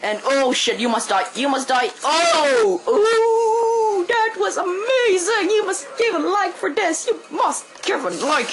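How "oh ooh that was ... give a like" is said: high, loud, thrilled yelling over his kill; excited shout. Real, not acted. Gamer yelling to viewers over an amazing kill, thrilled. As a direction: whoop like you just pulled off a miracle.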